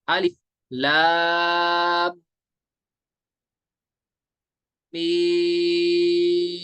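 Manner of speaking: "लाम" and "मीम" held very long with nasal hum between; madd with ghunnah. Shown as a demonstration. Teacher reciting for students, drawn-out chant. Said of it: "गुलना करेंगे हम".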